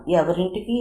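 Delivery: plain reading voice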